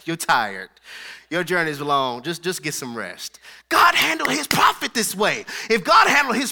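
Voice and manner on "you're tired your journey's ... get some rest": gentle angel. Retold or imitated. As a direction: soothe the worn-out prophet. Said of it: "the angel came to him and was like".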